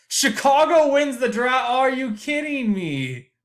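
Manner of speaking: loud, high disbelieving cry, slowing on the question; real shock at the lottery result; stunned outburst. Real, not acted. Podcaster reacting live to the lottery, loud stunned disbelief.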